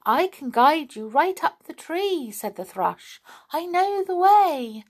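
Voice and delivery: high, bright little bird voice; eager helpful songbird; kindly thrush. A narrator's reading. Thrush offers to guide the doll up the tree.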